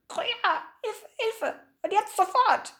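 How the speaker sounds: very high, croaking seagull voice; muffled squawk. A narrator's reading. Gull begs for help through the door, muffled squawking. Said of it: "Gedämpft kam von draußen krächzend zurück".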